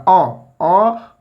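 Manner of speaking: "अ, अ" as two separate short-a sounds; isolated vowel demo. Shown as a demonstration. Teacher isolating the first sound "अ" for students, vowel demonstration. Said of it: "यहाँ अन का उच्चारण कर रहा है".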